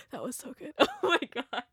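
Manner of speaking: breathless giggle at own joke; laughing. Real, not acted. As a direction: laugh through it.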